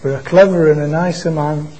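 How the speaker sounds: ordinary talk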